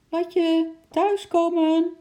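high, loud, drawn-out call; calling at full volume. A narrator's reading. Mother at the fence calls Lotje home, shouting. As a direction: holler it slowly across a distance. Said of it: "riep ze zo hard als ze kon".

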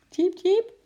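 high, loud little squirrel squeak; animal chirping. A narrator's reading. Squirrel Eki squeaks in the story. (hoog, luid eekhoorngepiep)

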